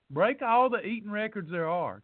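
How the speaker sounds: usual speaking voice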